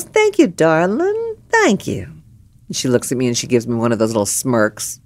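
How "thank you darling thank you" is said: high, slow sugary thanks; girlfriend's high, drawn-out thank-you to the bartender; sweet darling. Retold or imitated.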